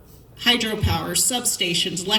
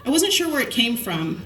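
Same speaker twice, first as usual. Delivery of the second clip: ordinary talk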